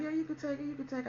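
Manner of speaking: a light touch of reassuring nurse; breezy nurse. Retold or imitated.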